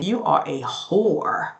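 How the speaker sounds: mock slow, spelled-out insult; slow emphatic quote. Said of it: "Angela saying".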